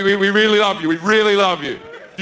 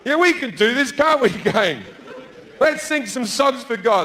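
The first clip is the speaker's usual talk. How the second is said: higher, eager and placating; eager partygoers; 6 semitones higher than usual. Acted. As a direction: soothe an angry God nervously. Partygoers nervously agree.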